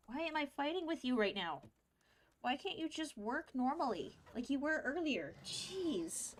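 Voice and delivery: high, soft-voiced grumbling; exasperated. Real, not acted. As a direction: plead crossly with a misbehaving machine. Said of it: "getting mad with my computer screen".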